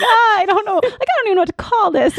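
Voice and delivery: a put-on panicky wail; mock panic. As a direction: fret like a flustered new mom. Panicked about naming breastfeeding.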